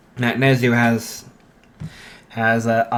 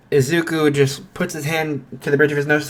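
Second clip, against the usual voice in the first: ordinary talk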